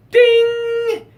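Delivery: high sung ding like an elevator bell. Pretend. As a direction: ring it out like a lift chime. Elevator door opens.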